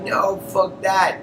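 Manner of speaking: high, slow, dismissive refusal; mock villain defiance. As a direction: spit it out slowly like a smug villain. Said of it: "Thanos is all like".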